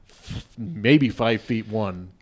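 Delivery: ordinary talk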